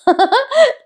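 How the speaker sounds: high, bubbly giggle; short high-pitched laugh; amused laugh. Real, not acted. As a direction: burst out giggling. Laughs at the poor friend's plight.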